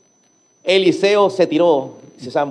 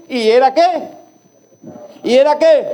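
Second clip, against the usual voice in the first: louder and higher, calling for an answer; rising call to the crowd; 7 semitones higher, 3 dB louder than usual. Real, not acted. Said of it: "preacher prompts the congregation". (llamada enérgica a la congregación)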